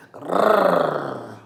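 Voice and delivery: a rumbling animal growl; coyote growl. Acted. Coyote growls at the lamb, menacing growl. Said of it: "The coyote growled".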